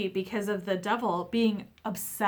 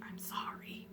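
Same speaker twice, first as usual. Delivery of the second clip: breathy whisper "I'm sorry"; whispered apology; 16 dB quieter than usual. Retold or imitated. Resting spirit whispering sorry to the hurting person, whispered. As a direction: whisper it like a confession.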